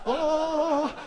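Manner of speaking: high strained mock cry "Ah"; hammed-up falsetto wail. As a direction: squeal as if taking off. Acting the moment.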